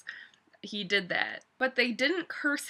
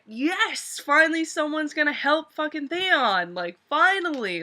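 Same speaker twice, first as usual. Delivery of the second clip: excited relief; 4 semitones higher, 4 dB louder than usual. Real, not acted. Relieved someone will help Theon.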